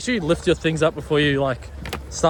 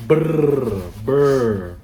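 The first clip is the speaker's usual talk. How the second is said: loud shivering brrr sound; teeth-chattering cold noise; 5 dB louder than usual. Shown as a demonstration. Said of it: "that's how cold it is".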